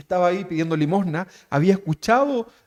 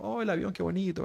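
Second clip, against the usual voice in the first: soft, passive little marvel; idle admiration; 2 semitones higher, 12 dB quieter than usual. Acted. Castaway just watches a plane pass, quiet idle wonder.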